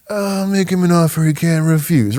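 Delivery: lightly voiced mafia don line; a hint of Brando's Godfather; Godfather quote. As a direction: mutter it like the old Don. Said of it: "classic mafia language".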